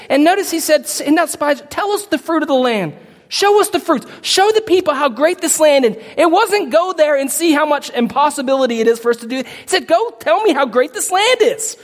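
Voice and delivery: high, pressing sermon voice; fervent preaching. Acted. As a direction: preach it with mounting urgency. Preaching with rising intensity.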